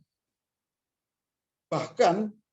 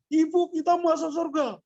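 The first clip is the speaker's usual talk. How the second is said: much higher, insistent scolding; scolding on the phone. Retold or imitated. Student phoning her sibling, a firm scolding. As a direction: tell the sibling off firmly. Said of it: "Marah-marah adiknya".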